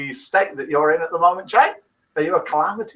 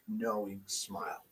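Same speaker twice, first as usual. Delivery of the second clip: ordinary talk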